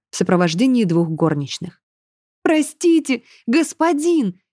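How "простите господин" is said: high, pleading cry of apology; pleading nanny. A narrator's reading. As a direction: beg forgiveness, voice up high. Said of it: "начала кричать Грета". (умоляющая няня, высоко и жалобно)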